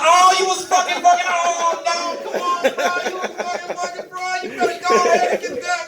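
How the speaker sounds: high, squealing mock excitement; hyped-up friend. Associate congratulating a friend on his date, hammed-up excitement. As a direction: cheer him on in a high, overjoyed voice.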